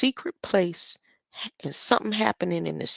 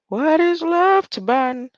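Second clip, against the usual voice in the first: mock outburst; 9 semitones higher, 7 dB louder than usual. Real, not acted. Mocking outburst about the speech.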